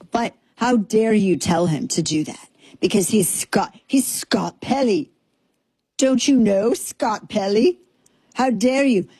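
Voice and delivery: lightly mock pompous mid-Atlantic anchor; a hint of mid-Atlantic indignation; mock Atlantic accent. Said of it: "into this quasi light British".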